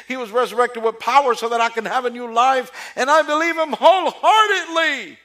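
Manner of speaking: building from confident to a high, loud, overblown proclamation; mock believer swelling into a loud high boast; mock pious believer. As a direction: ham up a smug churchgoer's testimony. Mocking a self-assured believer.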